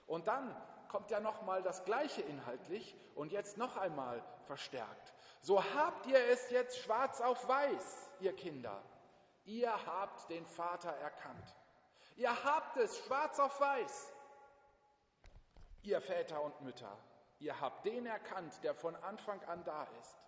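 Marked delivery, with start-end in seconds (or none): 5.4-14.1 emphatic proclamation. Acted. Preacher proclaiming scripture emphatically.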